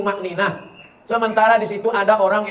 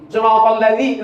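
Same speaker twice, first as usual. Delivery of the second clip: usual speaking voice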